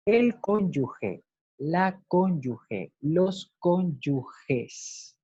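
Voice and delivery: El cónyuge said slowly, each word spelled out, j sound in cónyuge; slow, careful. Shown as a demonstration. Teacher slowly drills the correct form for the class. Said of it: "Lo correcto es escribir cónyuge".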